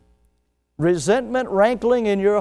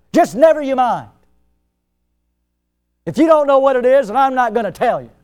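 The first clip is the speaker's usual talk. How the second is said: loud, higher, huffy refusal; indignant refusal; 7 semitones higher, 8 dB louder than usual. Retold or imitated. Offended friend refusing to say what's wrong, loud and huffy. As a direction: stonewall him, offended and loud.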